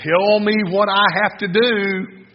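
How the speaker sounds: high, loud, slow insistence; eager demand. Acted. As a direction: press for the answer, drawn out and acted. Eager man demands answer from Jesus.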